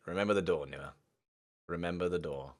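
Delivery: ordinary talk, nothing marked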